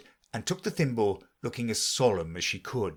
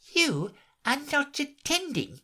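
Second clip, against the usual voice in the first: higher, slow stern mouse voice; severe mouse. A narrator's reading. Mouse reproaching inattentive Alice, severe. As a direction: scold her slowly and sternly. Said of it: "said the mouse to Alice severely".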